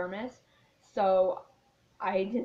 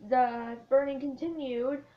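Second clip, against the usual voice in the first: usual speaking voice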